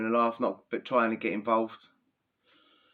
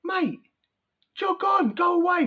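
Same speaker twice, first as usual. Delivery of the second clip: much higher, louder shout to get lost; fed-up inmate yelling him off; retold telling off; 5 dB louder, slower than usual.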